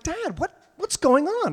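put-on high puzzled child voice; imitating the kids. Dad mimicking kids asking what's going on, high child voice. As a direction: ask it like a baffled kid.

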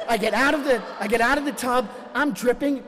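ordinary talk, nothing marked

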